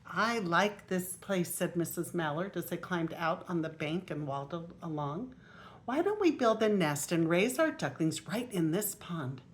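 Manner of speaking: lively storybook reading aloud; grandma reading a picture book aloud; reading to children. Acted. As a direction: read it out to the little grandchildren.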